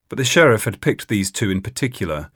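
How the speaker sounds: normal talking voice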